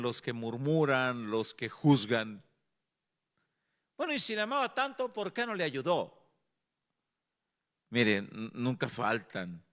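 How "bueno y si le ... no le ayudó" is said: quick, higher, scoffing voice of a critic; mock the gossips with a sneer. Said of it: "los que critican". (burla de los que critican)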